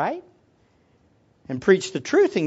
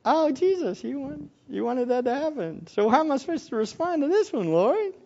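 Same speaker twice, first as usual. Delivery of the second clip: softer, sarcastic prayer to Jesus; mock prayer; 4 semitones higher, 6 dB quieter than usual. Driver cut off, complaining quietly to Jesus. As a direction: mutter a grudging prayer. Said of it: "You go".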